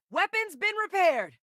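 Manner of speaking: high, sustained call; urgent call-out. Acted. Fighter telling the squad of a repaired weapon, high call-out. Acted call-out during combat.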